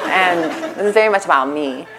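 normal talking voice

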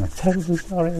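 high, whiny imitation of a sobbing patient; mock blubbering. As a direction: lightly mimic someone blubbering apologies. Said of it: "they're blubbering and saying".

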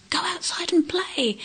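high-pitched motherly order; lightly voiced exasperated mum; insistent mum. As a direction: play a mum ordering her boy out, without shouting. Cartoon mum pushing boy outside.

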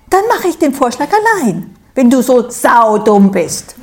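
high, loud defiant huff. A narrator's reading. Stroh defiantly going off alone, high and loud. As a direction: spit it out in a sulky huff. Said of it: "sagt Stroh trotzig".

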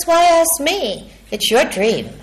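teasing monster, lightly voiced; mild monster voice. Monster answering the boy, lightly voiced. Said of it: "And the monster says".

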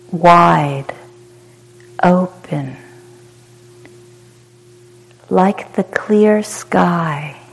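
calm, unhurried meditation guide with long pauses; short soothing phrases, falling gently into silence; guided meditation. Real, not acted. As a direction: guide them into spacious ease, letting each phrase settle.

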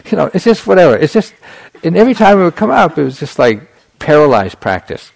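animated retelling. Retold or imitated. Teacher recounting his inner whining to students, animated. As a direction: tell it with rising energy, a touch of laughter.

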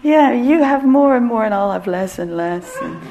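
loud whiny envy; strained envious voice. Retold or imitated. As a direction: protest getting out of balance. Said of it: "you can hear my voice like".

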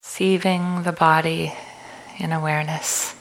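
calm, unhurried meditation guide with long pauses; guided meditation. Acted. Leads a walking meditation.

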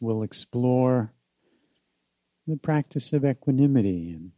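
unhurried meditation guide with long pauses; guided meditation. Acted. Teacher inviting listeners back home in the body, guided meditation.

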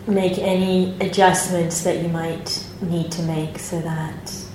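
calm, unhurried meditation guidance with long pauses; guided meditation. Acted. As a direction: gently guide the group into their posture and body.

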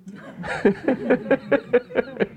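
amused high laugh; light chuckle rising high. Real, not acted. Laughs at own question.

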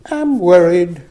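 put-on lilting sing-song little girl; a hint of a cheerful child, sung. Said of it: "in a sing-song voice".

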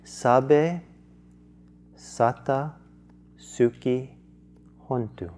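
one word at a time, spaced; staccato. Shown as a demonstration. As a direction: separate each chant word with pauses. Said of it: "So the chant is".

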